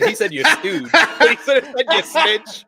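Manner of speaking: loud, high, cracking up; real laughter breaking into the words; laughing retelling. Real, not acted. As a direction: blurt the insult through laughter. Cracking up while retelling an insult.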